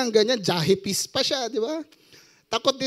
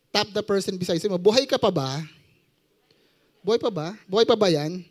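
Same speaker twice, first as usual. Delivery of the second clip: ordinary talk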